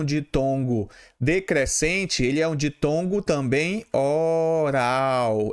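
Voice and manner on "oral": "oral" stretched slowly, o-raaw; drawn-out oral diphthong. Shown as a demonstration. Teacher demonstrating an oral diphthong, drawn out. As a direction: hold both syllables of "oral" long, without nasality. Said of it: "o som sai todo pela boca".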